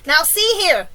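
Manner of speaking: loud, high, commanding call; shouted demand. A narrator's reading. Police chief confronts the centipede, shouting. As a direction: shout up for their attention. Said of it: "shouted the chief of police".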